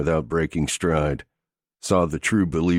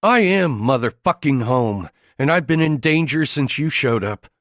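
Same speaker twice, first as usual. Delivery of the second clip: higher, louder furious woman; voice rising; 9 semitones higher, 4 dB louder than usual. A narrator's reading. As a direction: flare up at him in anger. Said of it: "voice rising".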